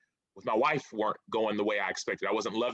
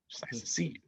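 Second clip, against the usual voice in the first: whisper; 6 dB quieter than usual. Retold or imitated. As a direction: repeat it in a breathy whisper, much softer. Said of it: "And he says".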